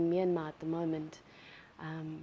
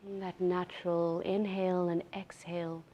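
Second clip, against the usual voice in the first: guided meditation. Real, not acted. Yoga teacher settling students into breath awareness, unhurried guided meditation. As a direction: ease them into stillness, leaving space between phrases.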